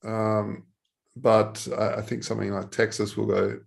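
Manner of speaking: ordinary talk, nothing marked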